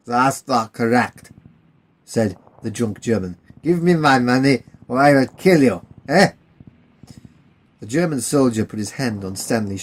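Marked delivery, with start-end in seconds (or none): 0.1-1.3 put-on hostile German soldier in a German accent; the German drunk telling the English to leave, German accent. 3.6-6.3 put-on hostile German soldier in a German accent; the German drunk telling the English to leave, German accent.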